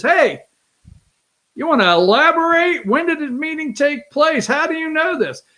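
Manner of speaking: eager mock-interviewer delivery; imitating a questioner. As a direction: play a reporter pressing for details. Acts out press man's questions.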